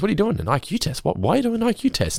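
high, prying questions; a hint of an intrusive Kramer; nosy Kramer. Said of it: "Then he comes over and goes".

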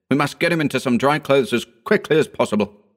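fast, urgent instruction. A narrator's reading. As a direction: hurry him into action. Urgent rescue.

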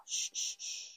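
very soft breathy shh; whispered shushing. A narrator's reading. Aunt calming the crying girl, whispered shush. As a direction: hush her gently. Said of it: "try and quiet her".

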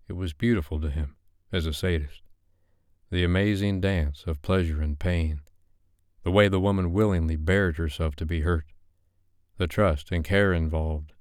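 ordinary delivery

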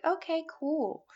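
high, drawn-out breezy okay; mock casual acceptance. Imitating herself accepting God's words, high and breezy. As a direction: pretend to just accept it. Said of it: "and like".

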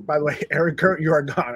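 normal talking voice

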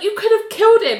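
high, loud reproach; hammed-up, high-pitched outraged blame; mock accusation. John accuses Nell in retold quarrel.

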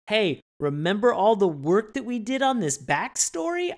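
high, emphatic nudge to the audience; mock boasting reminder. Writers reminding viewers of their work, mock boastful.

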